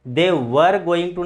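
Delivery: "were" as var with sounded r; wrong pronunciation. Shown as a demonstration. Teacher showing what not to say, "were" said as var. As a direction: slowly voice the r in "were". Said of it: "ये गलत है".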